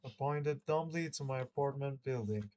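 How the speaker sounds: ordinary delivery, nothing marked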